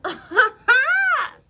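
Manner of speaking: loud high laughing shriek. Real, not acted. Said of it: "I'm laughing 'cause I can relate".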